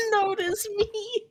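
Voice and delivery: anime fangirl squeal. Acted. Squealed anime fan plea.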